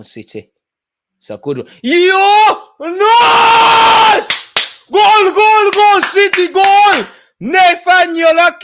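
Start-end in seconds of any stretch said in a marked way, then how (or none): 1.8-8.6 screamed goal celebration, much higher and louder. Real, not acted. Watching his club score late, a real ecstatic scream. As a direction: yell it like your team just won it late.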